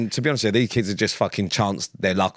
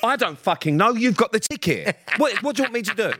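high-pitched exasperated comeback; retold exasperation; 11 semitones higher than usual. Snapping back at a mate about the ticket, exasperated. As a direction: act out throwing the blame back, pitched well up. Acted bickering over lost tickets.

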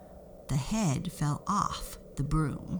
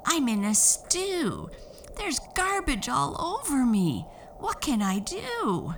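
put-on girl crying out; 10 semitones higher than usual. Nessie stuck in the waste basket, high upset cry. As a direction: wail it from the rubbish bin. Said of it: "she cried".

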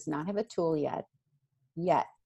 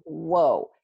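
ordinary talk

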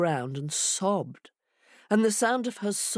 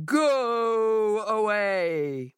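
big drawn-out boom, pitched up; booming monster voice; 5 semitones higher, 3 dB louder, much slower than usual. Acted. Monster inside the house warns Hare off, booming. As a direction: boom it out slowly, stretching each word. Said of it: "immediately the voice boomed out".

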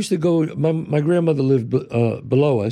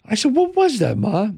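put-on curious kid; 10 semitones higher than usual. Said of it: "I go".

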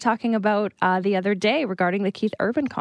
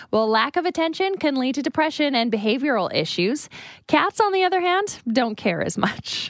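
steady radio news delivery; news read. Acted. Reads a news story.

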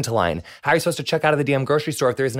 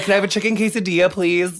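hammed-up Spanish accent, quick and higher; 6 semitones higher than usual. Host ordering a chicken quesadilla at Taco Bell, mock Spanish accent. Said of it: "I'm like".

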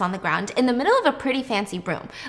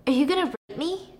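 usual speaking voice

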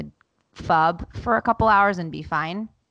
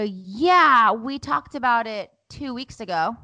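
raised pitch, exasperated comeback; indignant retort; 5 semitones higher, 4 dB louder, slower than usual. Retold or imitated. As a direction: push back hard on that excuse. Acts indignant retort to son.

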